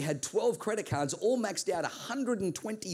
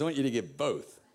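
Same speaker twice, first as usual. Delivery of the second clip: lower, firm voice of God; God's voice; 6 semitones lower than usual. Acted. God telling the preacher to give both bills, lower and firm. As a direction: state it calmly, with authority.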